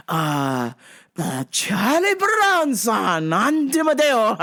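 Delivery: hammed-up Japanese accent with drawn-out fillers; terrible Japanese accent. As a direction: stall through it in mock Japanese-accented English. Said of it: "doing my terrible Oren Ishii accent".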